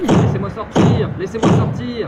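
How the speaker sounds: high, pleading calls from inside; desperate stowaway begging out; muffled cries for help. Acted. Stowaway shouting from the hold.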